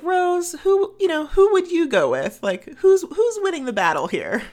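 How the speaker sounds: high, bright, teasing challenge; playful high teasing. Retold or imitated. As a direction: tease her to pick a side. [name] voiced teasing Rose.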